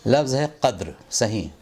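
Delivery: ordinary talk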